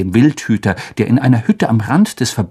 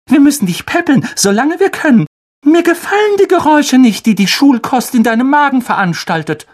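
much higher, sugary mother voice; doting mother; 13 semitones higher, 3 dB louder than usual. Acted. Mother cooing to her son, much higher. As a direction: coo at your darling boy.

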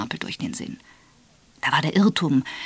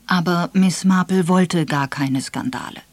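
usual speaking voice

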